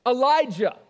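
loud, stern call to a son; firm parental summons. Acted. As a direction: call the name sharply as a father. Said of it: "that sounded kinda stern".

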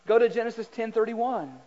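ordinary delivery

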